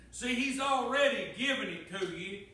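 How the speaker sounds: loud, ringing preacher voice, pressing each line home; full-force preaching. Acted. Preacher testifying God's control to church, loud preaching. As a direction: preach it out, building to a shout.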